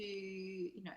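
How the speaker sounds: ordinary delivery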